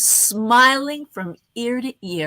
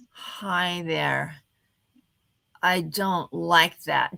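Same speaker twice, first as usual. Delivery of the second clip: slow easy-going; 4 semitones lower, much slower than usual. Shown as a demonstration. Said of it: "The slow, easy going".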